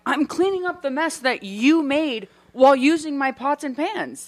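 indignant retort. Retold or imitated. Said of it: "I'm like".

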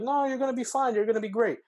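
high, sing-song mock reassurance; reassuring operators. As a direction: chirp it brightly like a cheery operator. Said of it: "but they're like".

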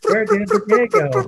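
very high, loud squeaky mock wail; frazzled mock cashier. Teasing his friend about the holiday rush in a squeaky wail. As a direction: play up the panic of a rushed clerk.

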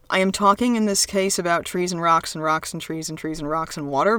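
usual speaking voice

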